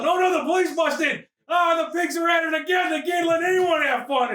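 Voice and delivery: high, hammed-up alarm; high protesting shout; mock-panicked shout. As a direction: cry out in comic outrage at a raid. Acting out a police bust.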